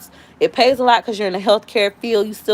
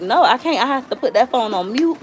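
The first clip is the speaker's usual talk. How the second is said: usual speaking voice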